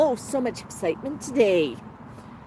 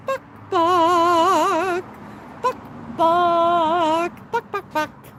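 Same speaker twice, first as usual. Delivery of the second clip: chicken clucking; 7 semitones higher, much slower than usual. Pretend. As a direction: cluck it out like a hen, pitched up high. Imitating a chicken call.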